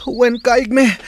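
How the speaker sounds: student shouting yes to his master mid-training; shouted reply. Acted. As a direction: bark the answer back to the master. Answering master during training.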